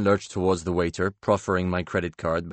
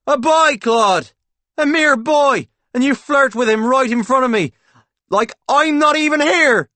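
very high, loud wounded indignation; jealous outburst; 10 dB louder than usual. Acted. Paul accusing Claude of flirting, melodramatic jealous shouting. Staged jealous row at the table.

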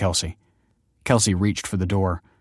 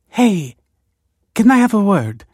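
high, louder, awkward approach; 13 semitones higher, 8 dB louder than usual. A narrator's reading. Woman waylaying Kelsey at the sink, awkward. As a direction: pipe up awkwardly. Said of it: "said Nina awkwardly".

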